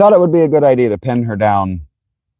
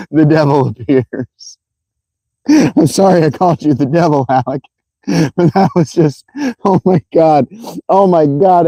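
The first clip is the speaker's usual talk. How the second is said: higher-pitched words through real laughter; laughing like crazy; 4 semitones higher than usual. Real, not acted. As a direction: apologize while cracking up. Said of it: "I'm laughing like an actual crazy person".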